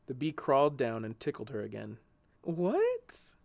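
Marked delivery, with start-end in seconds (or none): 2.4-3.4 high, rising 'what'; lightly laughing disbelief; amused question. A narrator's reading. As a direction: ask through amusement. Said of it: "she said, still laughing".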